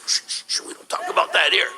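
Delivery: unvoiced hushed warning, like sharing a dark secret; hushed teen worker. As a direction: hush him like a nervous teen employee. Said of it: "He goes".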